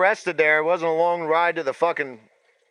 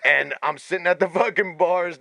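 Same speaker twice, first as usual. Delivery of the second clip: ordinary talk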